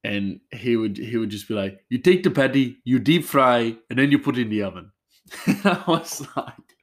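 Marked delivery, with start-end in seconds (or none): 1.9-4.9 imitated Indian accent, raised a little and louder; bad Indian accent. Coworker instructing the new chef, bad Indian accent. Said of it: "horrible Indian accent".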